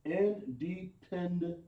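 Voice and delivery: "independent" slow, syllable by syllable; sounded out slowly. Shown as a demonstration. Teacher confirming the class's answer, "independent" sounded out slowly. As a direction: stretch out "independent" in separate parts.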